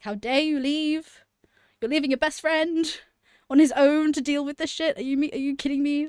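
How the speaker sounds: high, loud mock-indignant scolding; retold outrage. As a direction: replay being furious at a friend who left. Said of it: "I was so mad at him".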